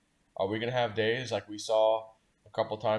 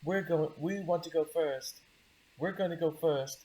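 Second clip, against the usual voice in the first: put-on high, slow little British girl voice; 7 semitones higher than usual. Friend's daughters insisting on going first, in a high British accent. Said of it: "they have this little British accent".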